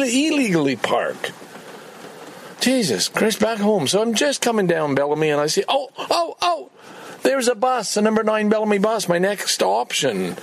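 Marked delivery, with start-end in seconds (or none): none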